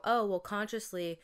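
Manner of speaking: normal talking voice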